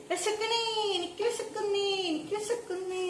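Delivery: put-on high whining little kid voice; whiny hungry child. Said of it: "എന്ന് പറയൂലല്ലോ ലച്ചു".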